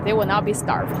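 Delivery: ordinary talk, nothing marked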